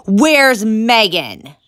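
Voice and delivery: slow, loud furious shout; angry shout. Acted. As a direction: demand an answer, exploding. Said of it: "He spun around and shouted".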